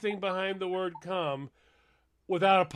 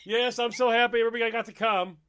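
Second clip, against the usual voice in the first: mock cheerful quote; 6 semitones higher, 9 dB louder, faster than usual. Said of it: "where you're going".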